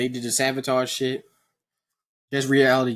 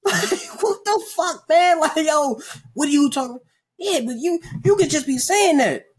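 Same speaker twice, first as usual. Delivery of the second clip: younger self voiced; 13 semitones higher than usual. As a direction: argue back, pitched far up. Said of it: "talking to my Duncan self".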